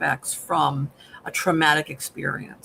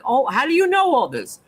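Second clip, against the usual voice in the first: higher, startled question; freaked out; 6 semitones higher than usual. Retold or imitated. Henry confronting Victor about the names, startled and higher. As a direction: recoil in alarm at what he knows. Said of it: "Henry right away freaks out".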